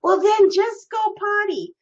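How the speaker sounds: high, exasperated comeback. Retold or imitated. Wife retelling her answer to husband's antics, exasperated.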